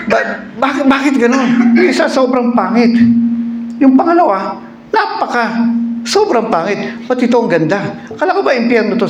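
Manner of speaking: high, laughing disbelief; puzzled. Acted. A man amazed his bride in hell is beautiful, high disbelief. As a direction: ask in high bewildered disbelief.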